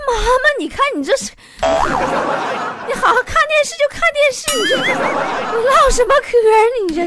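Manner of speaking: 急得直嚷嚷. Retold or imitated. Daughter protests mother's remark. (又高又快、又急又气地冲妈妈嚷)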